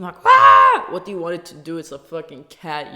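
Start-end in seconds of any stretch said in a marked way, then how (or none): 0.2-0.9 a short, very high, very loud cartoon shriek; cartoony scream. Shown as a demonstration. Mocking commenters, a cartoon cat scream. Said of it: "scream out a cartoony scream".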